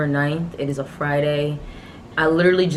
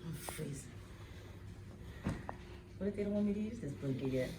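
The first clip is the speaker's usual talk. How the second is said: hushed; 18 dB quieter, faster than usual. Real, not acted. Hushed in hospital room.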